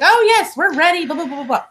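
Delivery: chirpy clerk voice. Retold or imitated. Rental clerk reassuring on phone.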